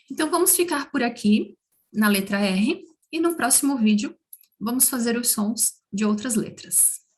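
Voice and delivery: ordinary talk, nothing marked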